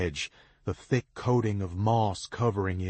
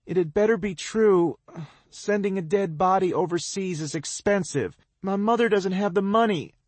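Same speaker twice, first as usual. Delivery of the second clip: high, loud, rushing words; panicked girl rushing her worries; talking quickly, emotional; 11 semitones higher, 5 dB louder than usual. A narrator's reading. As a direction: blurt it in pain. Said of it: "She's talking quickly".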